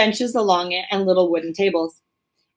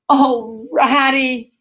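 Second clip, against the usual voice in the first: mildly dismal cry; 4 semitones higher, much slower than usual. A narrator's reading. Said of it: "he cried dismally".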